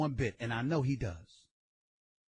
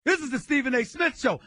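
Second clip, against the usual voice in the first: ordinary talk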